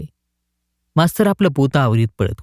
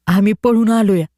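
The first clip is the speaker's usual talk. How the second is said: put-on higher boy's voice; village boy; 6 semitones higher than usual. A narrator's reading. A boy admits to the collector they ran away. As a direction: confess it simply.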